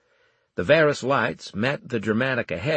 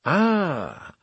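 lightly mimicked mannerism, higher; drawn-out mock-knowing exclamation; a hint of a copied grand manner; Armel; 7 semitones higher than usual. A narrator's reading. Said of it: "imitating Armel in my mannerism".

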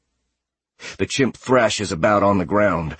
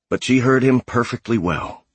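usual speaking voice

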